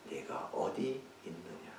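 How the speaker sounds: "네가 어디 있느냐?" soft and slow, "있느냐" asked tenderly; gentle question. Shown as a demonstration. God gently seeking hiding Adam, soft and slow. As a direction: ask gently, as if seeking him. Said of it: "그게 우리들에게 중요한 겁니다".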